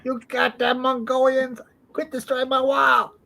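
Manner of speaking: high, slow mock Chinese outburst; South Park Chinese accent. Wall builder scolding Mongolians, in a mock Chinese accent. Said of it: "I think they were Chinese".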